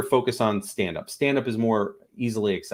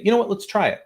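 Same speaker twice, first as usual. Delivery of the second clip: higher, louder, upbeat and welcoming; eager openness; 10 semitones higher, 4 dB louder, faster than usual. Acted. Boss welcoming a colleague's idea, eager and open. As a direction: sell the yes with bright enthusiasm. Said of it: "when you say".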